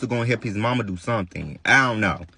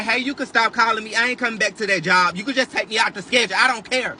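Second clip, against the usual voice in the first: higher, fast careless brush-off; dismissive quitting; 7 semitones higher than usual. Retold or imitated. Telling the lady at work she quits, dismissive and higher. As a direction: shrug off the boss. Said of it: "Get what I told the lady".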